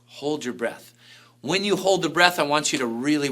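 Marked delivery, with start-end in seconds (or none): 0.1-0.9 soft instruction. Shown as a demonstration. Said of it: "instruct you to exhale and just hold your breath".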